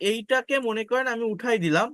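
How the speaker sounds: ordinary talk, nothing marked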